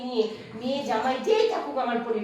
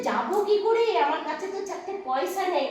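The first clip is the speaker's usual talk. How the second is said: helpless plea; 8 semitones higher, 4 dB louder than usual. Acted. Mother pleads poverty.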